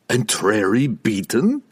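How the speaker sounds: gasping. A narrator's reading. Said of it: "he gasped".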